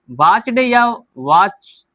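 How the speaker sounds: वॉच्ड या वॉच, the first ending in a half t, said slowly; teacher sets वॉच्ड against वॉच for students; slow careful contrast. Shown as a demonstration. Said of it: "आधा ट".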